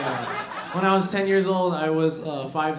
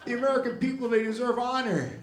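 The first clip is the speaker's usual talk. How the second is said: much higher, louder rising speech voice; Biden speech impression; 9 semitones higher, 4 dB louder than usual. Biden speech sliding into a sandwich order, rousing. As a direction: build a rousing speech that drifts off. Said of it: "He's like".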